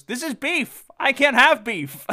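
put-on high, indignant old lady. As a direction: squawk like an offended old lady. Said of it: "And then she goes".

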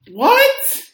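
a sudden high, loud "What?"; can't believe what he just heard; a disbelieving yelp; incredulous outburst. Real, not acted. Incredulous at the announcer's claim.

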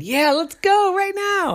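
put-on high, eager child's voice; a high little girl keen to go; eager kid. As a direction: rush him toward the slide. Sister eager for slide.

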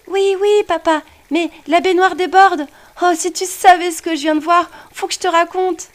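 put-on high, excited young boy; an eager child bursting to tell his dad; little boy voice. A narrator's reading. Boy character's voice.

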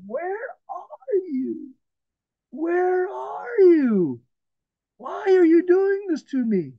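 very high, drawn-out plea; acted anguished crying out. Preacher voices a sufferer in darkness asking God why, crying out. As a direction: wail it slowly up to heaven.

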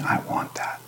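a longing, whispered plea; desperate whisper. Acted. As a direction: breathe it out, pleading, in a whisper. Desperate plea voiced in prayer.

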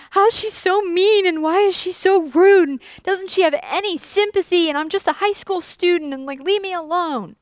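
high-pitched, whiny retold venting; upset teenager. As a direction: imitate your teenage self complaining, pitched well up. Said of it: "I was crying and I was really upset".

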